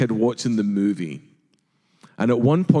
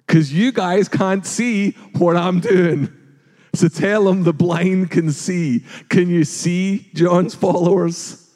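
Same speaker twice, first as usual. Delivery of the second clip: Jesus teasing; 6 semitones higher, 3 dB louder, slower than usual. Acted. Jesus voiced, teasing John's disciples.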